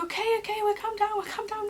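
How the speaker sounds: high, hurried-sounding reassurance; flustered agreement. Retold or imitated. Stepdad on the phone promising to come, flustered high reply.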